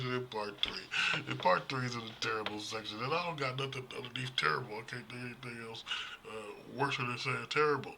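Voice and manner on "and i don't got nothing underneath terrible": normal talking voice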